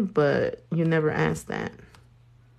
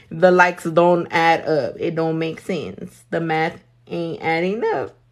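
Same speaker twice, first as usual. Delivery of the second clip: ordinary talk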